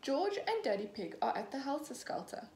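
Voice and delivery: usual speaking voice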